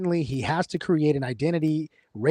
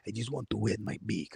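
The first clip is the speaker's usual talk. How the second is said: low, quiet mobster voice; Don Fanucci impression; 5 semitones lower, 11 dB quieter than usual. Mobster demanding his cut from a shopkeeper, low quiet voice. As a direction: purr it like a greedy old mob boss. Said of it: "like Don Fanucci on Godfather Two".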